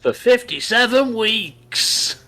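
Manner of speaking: high, loud drawn-out announcement; advert hard sell. Pretend. Topping the advert joke, slow high loud tagline. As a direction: stretch it out like an ad tagline. Calling back the mock advert.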